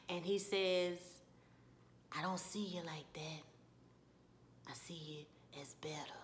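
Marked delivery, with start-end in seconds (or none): 2.2-6.1 low, hushed, halting tenderness; Ralph Angel. Retold or imitated. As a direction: confess softly, fading toward a whisper. Voices Ralph Angel's tender admission.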